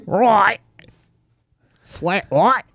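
mock South African accent, lightly done; hammed-up South African accent ordering coffee; lightly put-on South African accent. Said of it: "That's my South African".

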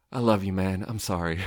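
ordinary talk, nothing marked

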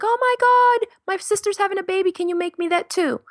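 high, loud, excited friend's plea; gushing friend. Retold or imitated. Friend begging her to sew a baby item, squealing high. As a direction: squeal like a thrilled friend asking a favor.

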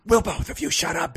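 loud stage whisper; a whisper that comes out loud; mock whisper. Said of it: "Billy whispered".

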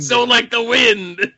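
high, slow, laughing grand delivery; dramatic movie quote. Pretend. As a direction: ham up the line through laughter. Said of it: "stealing from Three Amigos".

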